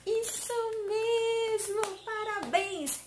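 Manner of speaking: high, drawn-out cheer for little pupils; singsong congratulations; delighted praise. Pretend. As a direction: celebrate the children's success in a high, stretched voice. Teacher cheers the children's answer.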